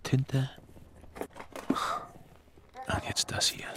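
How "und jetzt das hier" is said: breathy, slowed-down hush; drawn-out conspiratorial whisper; secretive whisper. Acted. Secretly producing the forbidden diary.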